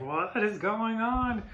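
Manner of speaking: high, slow, stunned "what is going on"; bewildered outburst. Retold or imitated. Frazzled parent baffled by a crying baby, high bewildered cry. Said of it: "You're just just like".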